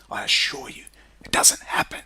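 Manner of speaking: whispered aside. Real, not acted. Ranting vlogger confiding to the viewers in a whisper.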